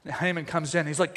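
ordinary talk, nothing marked

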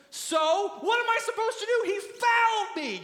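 high, loud, indignant argument; outraged yelling; shouting match. Acted. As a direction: argue back at the top of your voice. Acted shouting match, trainer and jockey.

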